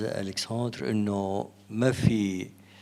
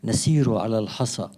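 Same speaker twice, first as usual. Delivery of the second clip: louder, measured declamation in formal Arabic; poetry recitation; 7 dB louder than usual. Acted. Poet reads from the book to a soirée audience, declaimed verse. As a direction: recite it out line by line, with spaced pauses. Recites own poem aloud.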